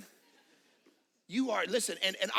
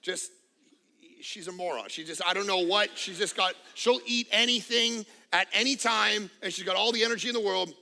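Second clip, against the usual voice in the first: normal talking voice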